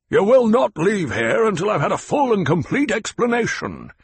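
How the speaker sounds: angry baron; demanding. Acted. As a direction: insist like a lord who will not be refused.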